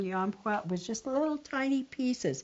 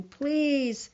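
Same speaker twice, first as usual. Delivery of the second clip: crying plea; 6 semitones higher than usual. Acted. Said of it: "he was crying and crying".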